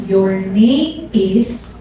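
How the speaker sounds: drawn out very slowly, rising at the end; slow rising prophetic drawl; slow prophetic call. Retold or imitated. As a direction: stretch each word like a prophecy being received. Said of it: "Tapos sabi niya".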